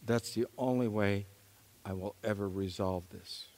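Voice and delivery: hushed, despairing self-talk; quiet despair. Retold or imitated. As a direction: sigh it out to yourself, defeated. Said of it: "I said".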